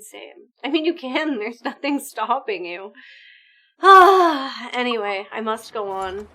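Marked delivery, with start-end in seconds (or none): 3.8-4.7 loud sigh. Real, not acted. Loud sigh after the chat talk.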